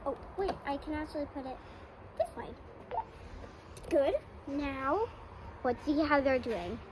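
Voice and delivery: usual speaking voice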